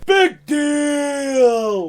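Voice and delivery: high, loud ring announcer call; announcer shout. Real, not acted. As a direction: bellow the name like an announcer. Shouts the nickname.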